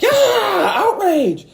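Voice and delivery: mock yourself going high and outraged; mock outrage. Imitating his own knee-jerk reaction, mock high outrage. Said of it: "I'm like".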